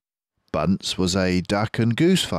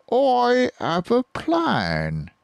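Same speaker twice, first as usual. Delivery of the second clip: much higher, very slow sly voice; Bean scheming; much slower than usual. Acted. Bean announcing his plan, slow sly high voice. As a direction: savour the words slyly. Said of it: "he said".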